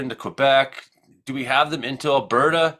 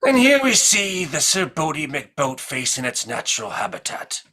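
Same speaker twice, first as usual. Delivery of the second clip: hushed, awed nature documentary narrator; David Attenborough impression; 5 semitones higher than usual. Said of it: "I do the Sir David Attenborough impression".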